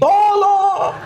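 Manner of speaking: hammed-up desperate yelp; mock desperate cry. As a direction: wail for help comically. Husband clawing the wall.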